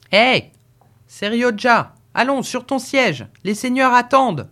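crie de loin, voix haute et forte; héler le postillon du dehors; appel crié. A narrator's reading. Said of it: "cria du dehors le maître de poste". (le maître de poste appelle Seriodja depuis la cour, crié fort)

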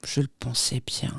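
low, very soft remark; grave. A narrator's reading. Grandmother answering her son-in-law, gravely. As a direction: drop it down gravely, almost under the breath. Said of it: "reprit-elle gravement".

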